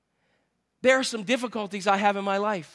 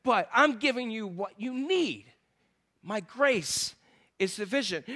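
God's quiet reply; 2 semitones lower, 6 dB quieter than usual. Acted. God explains grace to the complainer, quiet and measured. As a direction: answer softly, leaving pauses. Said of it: "And God says".

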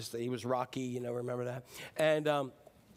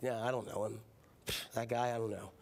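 dismissive denial; 6 semitones lower, 6 dB quieter than usual. Acted. Acted dismissive denial of knowing Jesus.

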